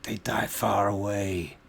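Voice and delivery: put-on orc voice in broken speech; orphaned orc child.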